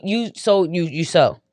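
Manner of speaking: normal talking voice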